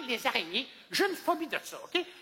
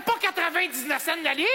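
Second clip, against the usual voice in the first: outraged disbelief; 7 semitones higher, 4 dB louder than usual. Retold or imitated. Outraged customer.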